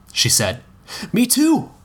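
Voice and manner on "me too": high, bright burst of recognition; a sudden eager yelp; excited exclaim. A narrator's reading. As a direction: blurt it out delighted. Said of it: "he exclaimed".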